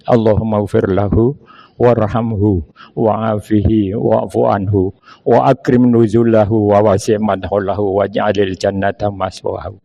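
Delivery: low, hushed Arabic prayer; prayer for the dead. Acted. Preacher praying for the late doctor, low and hushed.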